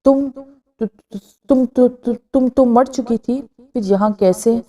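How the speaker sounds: high, slow, terrified stammering; a stammering friend staring at a dead girl's ghost, high and terrified; frightened stammer. Acted. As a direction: stutter out the words, stunned to see a ghost. Friends see murdered girl's ghost.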